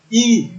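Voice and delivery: I said as a long "ee"; E pronounced "ee". Shown as a demonstration. Teacher explaining the English letter E, I said as "ee". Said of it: "La lettre E se prononce".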